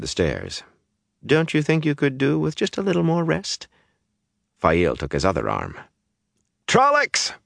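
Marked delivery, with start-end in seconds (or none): 1.2-3.7 very high, cajoling question; coaxing. A narrator's reading. Coaxing him toward bed. 6.7-7.3 very high-pitched distant alarm shout; muffled cry. A narrator's reading. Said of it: "The cry from outside came muffled".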